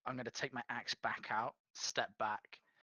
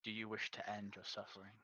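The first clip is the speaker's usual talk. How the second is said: whisper; 3 semitones lower, 8 dB quieter than usual. Acted. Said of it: "say again in the same tone".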